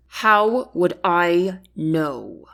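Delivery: slow, held-back words through the teeth; through his teeth. Acted. Chancellor to queen, slow and through his teeth. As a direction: grind it out slowly. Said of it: "he said through his teeth".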